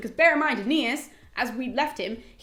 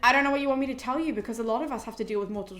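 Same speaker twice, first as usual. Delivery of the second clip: brisk offhand consoling; faster than usual. Retold or imitated. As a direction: rattle off half-hearted comfort. Dione's offhand reply to crying daughter.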